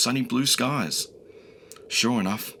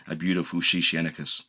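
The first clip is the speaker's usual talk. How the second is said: plain reading voice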